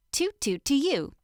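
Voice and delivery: plain reading voice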